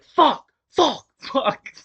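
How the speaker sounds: high, loud yells of pain, fading slightly on the last; pained yelling. Retold or imitated. Boy with broken arm yelling on the mat, loud pained yells. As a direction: shout it out as the broken arm hits. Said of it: "like, yelling 'cause I broke my arm".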